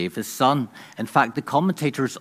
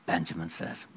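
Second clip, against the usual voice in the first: ordinary talk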